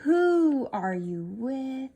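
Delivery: WHO drawn out long at the start of a slow question. Shown as a demonstration. Teacher giving pupil a sentence starter, slow questioning. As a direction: stretch WHO, asking slowly. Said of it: "we are asking a question".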